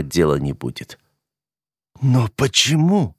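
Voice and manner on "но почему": choked rasp. A narrator's reading. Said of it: "сдавленно прохрипела она".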